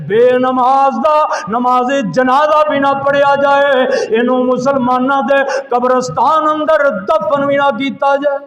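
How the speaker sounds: loud, high, ringing sermon delivery; fiery preaching. Acted. Preacher urging the congregation to pray, fiery preaching. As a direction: preach forcefully, driving each phrase out.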